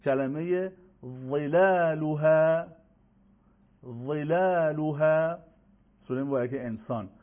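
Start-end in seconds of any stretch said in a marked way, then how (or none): 1.3-5.4 very slow "ظلالها" with a thick first consonant; heavy za example. Shown as a demonstration. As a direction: draw out each syllable of "ظلالها", full emphatic ظ. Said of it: "سومین کلمه".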